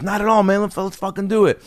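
high-pitched, eager imitation. Acts out [name] agreeing.

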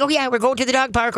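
mock-excited owner voice; excited dog talk. Shown as a demonstration. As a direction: get the dog all wound up. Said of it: "just get wiggle buddy".

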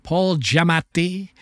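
Giamatti drawn out slowly, Italian-style; slow, deliberate Giamatti with a full double T; proper pronunciation. Shown as a demonstration. Host introducing himself with his proper name, showily pronounced. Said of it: "with the proper pronunciation from now on".

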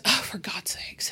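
exasperated whisper; slow, exasperated whisper. Retold or imitated. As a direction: mutter it under the breath, slowly. Teammate's exasperated aside.

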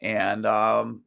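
usual speaking voice

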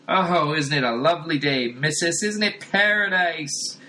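delighted street dweller telling his tale; cheery street fellow. Acted. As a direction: gush about paradise like a happy vagrant. Street dude tells his story.

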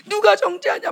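imitating a weeping proclamation; mock tearful cry. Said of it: "막 울면서".

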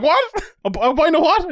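high baffled question; confused. Retold or imitated. Barman baffled by the order, asking back in confusion. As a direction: ask it completely lost. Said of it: "I was like".

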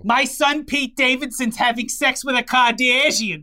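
put-on high, loud Staten Island accent; hammed-up Staten Island voice; Staten Island mother. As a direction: brag like a Staten Island mom. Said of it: "the woman from Staten Island".

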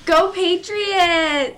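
loud, high, drawn-out cheer; fan cheer. Acted. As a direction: roar it like a sports fan. Cheering for the team.

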